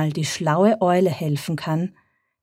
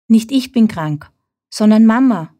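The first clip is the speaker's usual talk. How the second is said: sad calling after; 5 semitones higher, 6 dB louder than usual. A narrator's reading. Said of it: "ruft er ihnen traurig hinterher".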